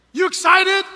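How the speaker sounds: high, loud cheerleading question; shouted hype question to a toddler; excited shout. Retold or imitated. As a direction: pump the kid up. Dad hyping toddler.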